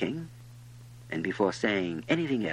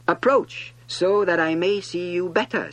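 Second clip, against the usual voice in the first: high, loud royal summons; proud king; 9 semitones higher, 8 dB louder than usual. A narrator's reading. King calling the prince closer, proudly commanding. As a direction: summon him with great self-importance. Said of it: "felt consumingly proud".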